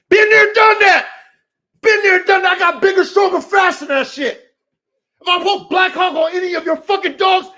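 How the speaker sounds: loud, high shouting at a rival; real furious shouting rant; furious callout. Real, not acted.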